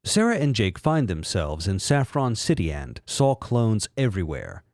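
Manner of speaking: ordinary delivery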